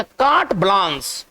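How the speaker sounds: कार्ट ब्लांस said slowly, each word distinct; pronunciation demo. Shown as a demonstration. Teacher showing students how to read it, कार्ट ब्लांस said slowly. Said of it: "इसको पढ़ेंगे क्या".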